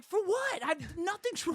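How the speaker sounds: high-pitched, incredulous retort; mock-defensive squeal of protest; acted-out baffled objection; indignant protest. Protesting to wife in retold exchange.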